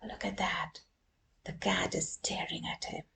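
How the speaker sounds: soft, whispered aside; quiet secret aside; hushed aside. Shown as a demonstration. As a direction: whisper it to the kids. Pointing out to children.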